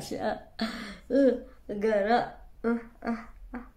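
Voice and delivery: lip tucked over pouch; speaking around tobacco pouch; talking with snus under lip. Real, not acted. Seen 2: fingers tuck pouch under lip; grimacing while it stays, later spit.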